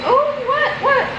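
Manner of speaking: high startled squeak. Real, not acted. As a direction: jump at the sound. Startled by a strange noise.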